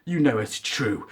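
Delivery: low, quiet, cruel taunt; mock Kylo twisting the knife. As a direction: Kylo tells Rey her parents were nobody, low and cold. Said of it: "Kylo just says".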